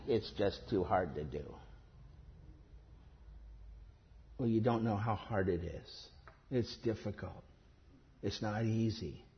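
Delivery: low, soft mock excuse; mock complaining believer. Preacher mimicking believers' excuses, low and soft mock whine. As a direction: mimic a sulky believer making excuses to God.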